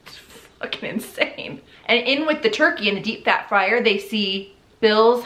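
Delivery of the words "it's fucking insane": trailing into soft, breathy disbelief; slow, breathy finish through suppressed laughter; stifled laughter. Real, not acted. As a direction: hold back a laugh, voice dropping away. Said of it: "Sorry, I'm laughing because".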